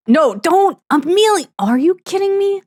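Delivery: high-pitched playful scolding; exasperated disbelief. Pretend. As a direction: protest in high, shocked disbelief. Scolding game character for eating cake.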